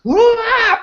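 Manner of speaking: sudden high excited squeal. Retold or imitated. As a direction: burst out high like a hyped-up owner. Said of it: "You're literally going".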